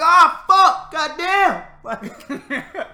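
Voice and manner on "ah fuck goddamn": high, loud pained cry with a laugh; pained Job. Retold or imitated. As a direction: yelp like a hurt man, half laughing. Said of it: "He'd be like".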